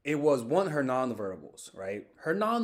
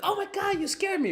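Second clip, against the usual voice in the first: high falsetto mock fright; mock startled. Scared passerby to the one who spooked them, mock startled. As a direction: squeal as if just spooked. Acting out someone scared by him.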